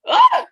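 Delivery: short, very high, loud shriek; sudden shriek. Acted. Acting out the scene, she suddenly shrieks at the baby news. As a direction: let out a sharp startled scream.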